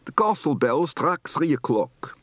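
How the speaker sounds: thick Dutch accent, slowed down; strong Dutch accent on an English sentence; heavy Dutch accent. Shown as a demonstration. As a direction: lean hard into Dutch vowels and "sree" for three. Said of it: "Heavy accent".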